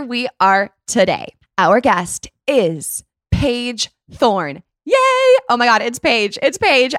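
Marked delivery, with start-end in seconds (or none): 1.6-6.9 gleeful, building to a high, loud squeal; excited reveal. Real, not acted. Host announcing her guest to listeners, excited. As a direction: draw out the announcement, then burst out cheering.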